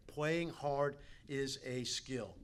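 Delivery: very slowly, each word weighed; slow emphatic. Shown as a demonstration. Coach slowly drilling his motto into the players. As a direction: lay it down slowly so every word sinks in. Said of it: "I think I said this first".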